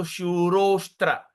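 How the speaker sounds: shurostra in one slow word, no A before STRA; silent A. Shown as a demonstration. Teacher explains the silent A, joined-word demo. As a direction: draw out SHURO and join straight to STRA. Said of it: "A silent".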